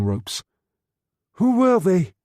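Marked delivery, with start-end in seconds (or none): 1.3-2.1 breathless gasp, much higher; shocked, breathless question. A narrator's reading. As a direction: gasp it out in fear. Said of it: "she gasped".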